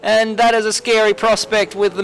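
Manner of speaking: motorsport commentator. Real, not acted. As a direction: call the crash as it happens. Live play-by-play of crash.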